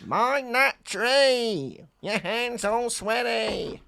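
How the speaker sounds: high, slow cartoon voice; Wallace and Gromit. Warning about a tree in a drawn-out, high cartoon voice. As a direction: call it out high and loud, vowels very long. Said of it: "He sounds like Wallace and Gromit".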